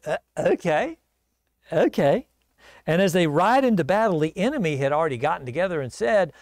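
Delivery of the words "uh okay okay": dopey, hesitant put-on voice; dim-witted king agreeing. Dim Jehoshaphat agreeing to Ahab's plan, dopey.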